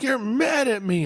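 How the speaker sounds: high-pitched mock-upset child voice; tearful young son. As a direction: imitate a small boy's hurt, high accusation. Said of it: "he breaks into tears".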